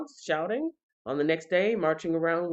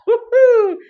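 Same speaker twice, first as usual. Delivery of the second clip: wild cheer; 14 dB louder than usual. Real, not acted. Cheering as walls fall.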